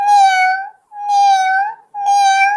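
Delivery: loud high meowing; pretend cat meow. Simba pretending to be a pussycat, loud high meows.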